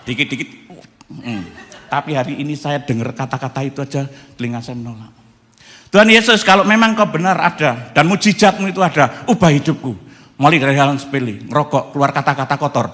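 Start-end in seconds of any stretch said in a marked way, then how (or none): 5.9-12.9 crying-out prayer. Retold or imitated. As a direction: cry out to God for change. Repeats prayer to Jesus.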